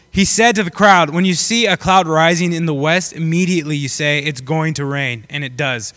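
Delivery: emphatic public Bible reading, opening loud; scripture reading. A narrator's reading. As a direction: read the verse out to the congregation. Reads scripture aloud.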